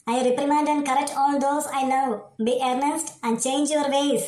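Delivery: mother solemnly reads scripture to her son; scripture reading. Acted. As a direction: proclaim the verse like a preacher, slowly. Mother reads a Bible verse.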